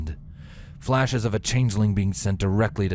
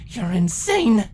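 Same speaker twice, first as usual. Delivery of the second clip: outburst; 13 semitones higher, 4 dB louder, slower than usual. Acted. Twilight yelling insult.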